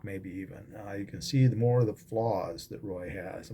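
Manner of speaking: usual speaking voice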